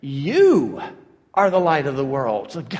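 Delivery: "You" swelling in pitch and held alone, then a pause; emphatic YOU. Retold or imitated. Jesus turns to his disciples, long stressed "You". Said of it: "when Jesus turns around and says".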